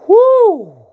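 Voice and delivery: loud high whoo; whooping exclamation. Real, not acted. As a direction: let out a big high whoo. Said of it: "We knew we were in a lot of trouble".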